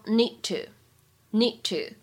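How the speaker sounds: "need to" with the d unreleased, slow; slow demo of "need to" with no release of d; dropped plosive. Shown as a demonstration. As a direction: swallow the d before to. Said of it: "完全失去爆破".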